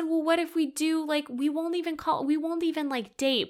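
high-pitched imitation of bargaining; retold pleading. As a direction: bargain in a high voice, re-enacting a plea. Retells bargaining with her ex.

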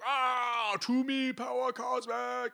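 very high, loud drawn-out cry; hammed-up villain invocation; Galactus summoning power. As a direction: summon the cosmos in a stretched, high yell. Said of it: "Galactus is all like".

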